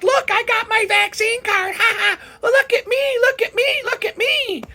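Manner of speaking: very high, squeaky gloating with a mock laugh; mocking coworker. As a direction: beg for attention like a bragging child. Mocking coworkers showing vaccine card.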